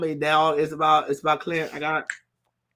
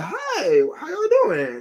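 high-pitched casual hello; breezy greeting impression; 13 semitones higher, much slower than usual. Yelena strolling in confidently, high breezy greeting.

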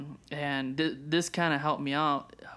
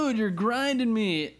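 exasperated complaint; 7 semitones higher, 4 dB louder than usual. Retold or imitated. Frustrated dad yelling at crying baby.

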